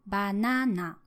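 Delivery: banana with the pitch peak on the middle NA; middle-peak pitch accent. Shown as a demonstration. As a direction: rise to the second syllable of banana, then drop. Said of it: "three possible ways to say banana".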